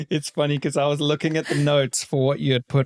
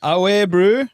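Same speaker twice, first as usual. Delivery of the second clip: higher, rising South African slang; rising South African greeting; South African accent demo; 5 semitones higher, 4 dB louder, slower than usual. Shown as a demonstration. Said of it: "try an easier one".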